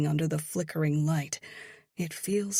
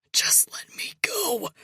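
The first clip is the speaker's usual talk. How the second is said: high, breathless, tearful plea; panting and crying; 13 semitones higher, 3 dB louder than usual. A narrator's reading. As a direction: pant the plea out, near tears. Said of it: "he pants".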